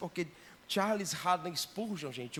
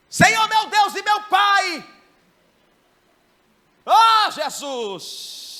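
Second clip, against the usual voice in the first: hammed-up booming shouted prayer, high and slow; mock loud prayer; 17 dB louder, much slower than usual. Preacher mocking a loud believer, booming shouted prayer. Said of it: "crente que não sabe orar baixo".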